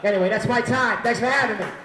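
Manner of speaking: high, loud closing thanks; sign-off shout. Real, not acted. Comic closes his set to the crowd, loud sign-off. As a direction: call it out to the whole room.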